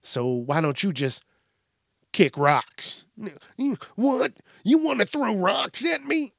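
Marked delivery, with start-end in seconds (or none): 3.6-6.3 high-pitched, incredulous comeback; mock indignant disbelief. Nosy stranger mishears "kick rocks", mock indignant. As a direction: squeak it out as someone wrongly offended. Acting out an offended person mishearing him.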